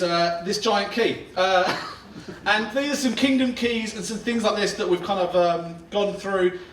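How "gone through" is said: ordinary talk, nothing marked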